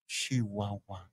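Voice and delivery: low, soft, drawn-out purr; sensual and sexy. Retold or imitated. Server telling a customer where she is from, sultry and sensual. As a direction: linger on the word seductively. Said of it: "They make it so sensual and sexy".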